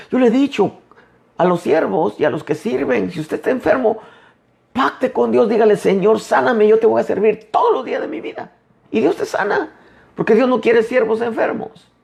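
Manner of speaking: high-pitched, rising preacher's call; fervent preaching. Acted. Preacher urging sick servants to make a pact with God, high and fervent. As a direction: lift the voice high and urge them on.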